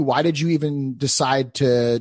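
normal talking voice

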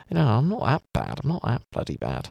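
ordinary talk, nothing marked

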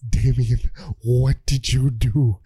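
low, slow stern father; Bruce. Retold or imitated. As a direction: ask it gravely, drawn out and deep. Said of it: "Bruce comes in and he's like".

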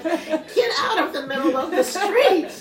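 high, slow, drawn-out mother's yell with laughter; alarmed shout. Retold or imitated. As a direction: bellow the warning, stretching the words. Retold mother yelling warning to daughter.

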